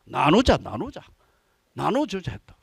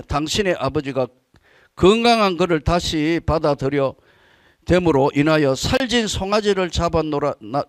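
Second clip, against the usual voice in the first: measured Bible reading, slightly raised; scripture reading; 4 semitones lower than usual. Acted. Pastor reading Luke to the church, measured and solemn. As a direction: read the parable out to the congregation. Reads the parable aloud.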